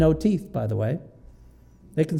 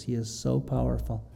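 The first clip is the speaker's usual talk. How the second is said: softer, lower echo of the line; softly repeated; 3 semitones lower, 8 dB quieter than usual. Real, not acted. Preacher repeating God's power to the congregation, softer and lower. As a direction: let it sink in, quietly. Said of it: "He is so powerful in this passage".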